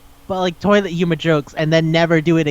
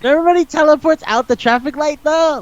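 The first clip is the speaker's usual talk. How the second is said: high, excited disbelief; incredulous; 9 semitones higher than usual. Real, not acted. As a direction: pitch it way up in amazement. Baffled at absurd movie plot.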